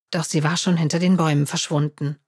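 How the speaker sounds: plain reading voice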